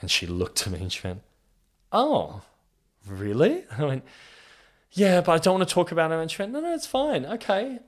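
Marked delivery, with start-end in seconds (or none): 1.9-3.7 1 surprised sister. Retold or imitated. Sister reacts to coming out. 4.9-6.2 1 flustered insistence. Retold or imitated. Insists he won't talk. 6.5-7.8 much higher, light mock-sister voice; sister reassuring. Sister calming her brother, high mock-reassuring voice.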